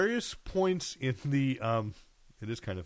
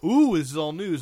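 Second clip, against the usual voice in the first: higher, louder fake excitement; mock hype; 4 semitones higher, 7 dB louder than usual. Podcaster mocking hyped-up news headlines, mock excitement. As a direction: play up an oohing hype voice. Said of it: "It's just like".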